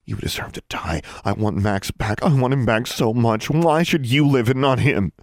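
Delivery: anguish swelling into fury; grief rising to anger. A narrator's reading. Grieving rage, trembling near tears.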